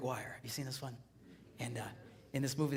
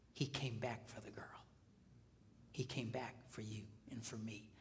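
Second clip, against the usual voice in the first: soft, near whispered, tender; hushed sincere reverence; 4 semitones lower, 11 dB quieter than usual. Real, not acted. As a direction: let it land softly. Tender climax of sermon.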